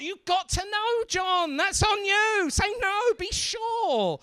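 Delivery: high-pitched pleading reprimand; mock-despairing telling-off; exasperated. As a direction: scold in a high, incredulous voice. Mock exasperated scolding of John.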